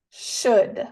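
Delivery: "should" said alone with no L sound; silent L in should. Shown as a demonstration. Teacher has learners practise the word, careful "should" without L. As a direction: model "should" with the L left silent. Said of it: "Practicamos una vez más pronunciar esta palabra".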